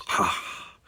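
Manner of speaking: soft breathy sigh. A narrator's reading. Louis hears his friend's plan, sighing. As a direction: let out a quiet, voiceless sigh. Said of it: "Louis sighed".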